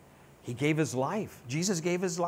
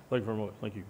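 [name] impression; 8 semitones lower, 8 dB quieter, much faster than usual. [name] catchphrase after naming [name].

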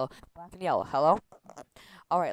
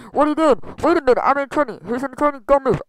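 high, loud panicked outburst; acted panic; 8 semitones higher, 8 dB louder than usual. Alarmed characters yelling at Barry not to move, panicked. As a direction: blurt it out loudly in alarm.

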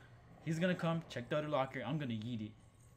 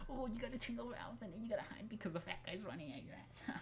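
high, softer mocking singsong; 10 semitones higher, 6 dB quieter than usual. Player mocking why he disliked this part, high mocking voice. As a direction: parrot it back in a high, silly voice. Mocks the game's demands.

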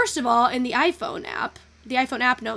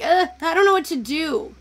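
mock exasperated groan, higher and louder; frustrated; 4 semitones higher, 7 dB louder than usual. Streamer retells editing frustration, mock exasperated. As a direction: whine it in defeat. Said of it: "I'm like".

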